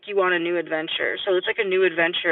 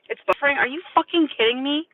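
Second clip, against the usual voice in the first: exasperated outburst; 7 semitones higher, faster than usual. Real, not acted. Stream buffering mid tarot reading.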